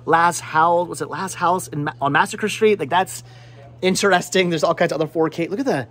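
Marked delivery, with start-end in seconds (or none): none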